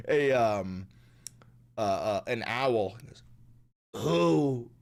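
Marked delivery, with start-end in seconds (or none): 3.9-4.7 lightly voiced owl hoot; Donald Trump. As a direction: imitate an owl-like politician hooting. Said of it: "this is my impression of Trump".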